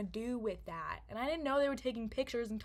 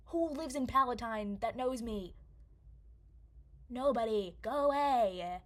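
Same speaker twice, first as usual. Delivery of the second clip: exasperated outburst; 3 semitones higher, 6 dB louder than usual. Real, not acted. Storyteller interrupted by a nuisance phone call, exasperated.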